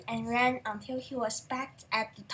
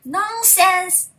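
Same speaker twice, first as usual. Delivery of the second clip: loud higher brush-off; dismissive; 6 semitones higher, 12 dB louder than usual. A narrator's reading. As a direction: wave it away loudly. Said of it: "said his wife".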